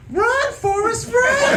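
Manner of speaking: very high-pitched comic cry; mock-panicked high yell; involuntary scream. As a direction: let a high, urgent shout jump out. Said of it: "I went to scream, but this voice jumped out of me".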